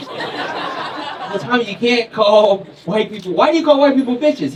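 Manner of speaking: loud, high disbelieving scolding; mock shock. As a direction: react like a scandalized counselor. Acted shocked counselor scolding child.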